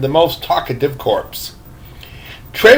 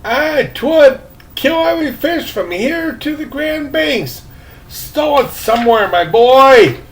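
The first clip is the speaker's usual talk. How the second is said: put-on high old man voice; 8 semitones higher than usual. A narrator's reading.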